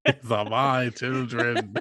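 slow, mock-theatrical echo; Phantom of the Opera. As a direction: mimic a grandiose stage villain, drawn out. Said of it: "Is he the Phantom of the Opera".

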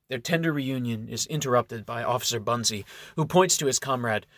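ordinary delivery

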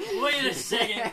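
high, loud, drawn-out alarm with laughter; shocked protest. Real, not acted. Friend thrown by incest question, shocked protest. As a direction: halt them, loud and incredulous.

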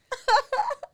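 a bright high burst of laughter; sudden squeal of a laugh. Real, not acted. Laughing while retelling scene.

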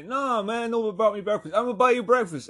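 high, loud mock banter; hollered exchange. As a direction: imitate shop chatter called out at full voice. Retold loud exchange in bagel shop.

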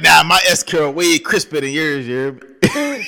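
high, loud, drawn-out bragging; mock boasting. Man boasts his curl beats his rival's, hammed-up bragging. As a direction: brag indignantly as a rival would.

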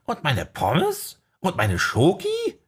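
slow, high pleading sidekick; whiny sidekick. Acted. Archie begging his boss for snacks, slow high pleading.

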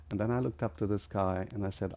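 usual speaking voice

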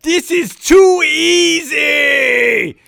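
put-on slow, loud, high shout in a Schwarzenegger-style Austrian accent; triumphant yell in an Arnold Schwarzenegger-style Austrian accent. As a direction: bellow it slowly in a mock Schwarzenegger Austrian accent. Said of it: "in a very Arnold Schwarzenegger style accent".

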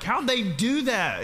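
amazed viewer. Acted. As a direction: marvel at the stunt. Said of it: "You're wondering, like".

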